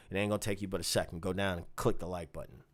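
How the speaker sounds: ordinary talk, nothing marked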